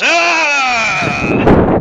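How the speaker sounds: long, high, loud "aah"; attacker's yell. Acted. Lumbering fighter after slamming his foe down, loud yell. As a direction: roar as the blow lands. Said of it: "he kinda just goes".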